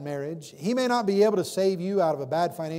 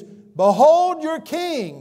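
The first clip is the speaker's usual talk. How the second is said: high, loud, very slow proclamation; 12 semitones higher, 11 dB louder, much slower than usual. Acted. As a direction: announce him slowly to the whole crowd. Pilate presents Jesus to crowd.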